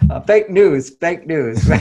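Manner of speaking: hammed-up jab at the slogan, voice lifting then settling; president impression. Speaker mocks the president repeating fake news, lightly imitating. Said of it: "our great president in America saying".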